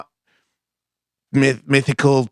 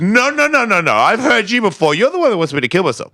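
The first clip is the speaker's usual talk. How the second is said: emphatic refusal; 11 semitones higher than usual. Retold or imitated. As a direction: shut it down, high and insistent. Rejecting the bad voice.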